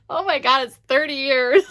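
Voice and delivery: high, loud, overwhelmed and close to tears; tearing up. Real, not acted. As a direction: exclaim through teary laughter. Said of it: "made me wanna tear up".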